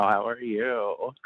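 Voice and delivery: slow, stoned and giggly; lazy high question with a giggle. Real, not acted. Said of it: "Sorry, I'm really stoned right now".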